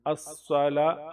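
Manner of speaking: ordinary delivery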